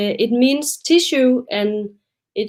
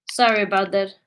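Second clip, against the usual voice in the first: ordinary talk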